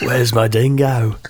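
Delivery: slow, drawn-out mock Australian; laughing Australian put-on; Australian accent. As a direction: stretch it into a caricature Australian twang. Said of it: "can't do an Australian accent".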